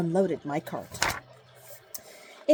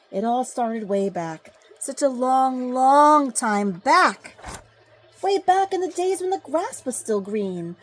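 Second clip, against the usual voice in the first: louder, drawn-out long time back; storytelling swell; 5 semitones higher, 7 dB louder, slower than usual. A narrator's reading. Onceler reminiscing to the child, swelling and drawn out. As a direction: savor the memory, stretching long. Onceler begins his tale.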